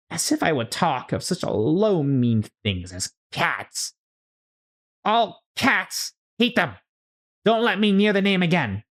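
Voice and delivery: high, indignant rant; angry mouse. Acted. Trembling mouse ranting about cats, high and indignant. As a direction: rail against cats, trembling with rage.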